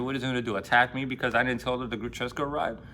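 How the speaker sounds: normal talking voice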